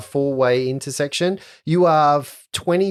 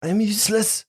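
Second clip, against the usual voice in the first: high mopey voice; 9 semitones higher than usual. Acted. GM voices Saloni.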